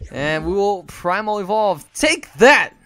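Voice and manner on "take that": loud, high gloating yell; playful battle cry; triumphant shout. Real, not acted. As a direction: slam it down in triumph. Evolving his Pokemon.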